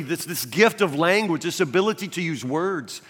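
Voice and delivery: normal talking voice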